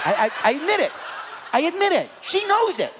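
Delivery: high, loud laughing admission; laughing confession. Real, not acted. Laughing confession of skipping beds.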